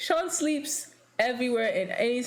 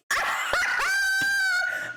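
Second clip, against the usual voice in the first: howling with laughter at the memory. Real, not acted. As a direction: burst into a high screaming laugh. Loud laughing outburst.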